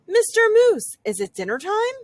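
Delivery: high, eager little rabbit; squeaky, eager bunny question. A narrator's reading. Rabbit character voice.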